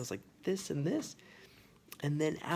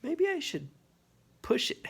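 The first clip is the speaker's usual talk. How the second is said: high, sneaky inner voice; mischievous thought; 14 semitones higher than usual. Retold or imitated. A nine-year-old's sneaky thought, pitched up high. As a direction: voice the naughty little idea, pitched up. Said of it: "I just had this thought".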